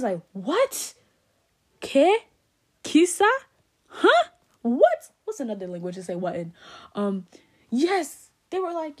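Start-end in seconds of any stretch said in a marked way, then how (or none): 0.3-5.1 high, loud, disbelieving questions; acting out loud disbelief; incredulous reaction. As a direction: fire off stunned one-word questions, each pitched up.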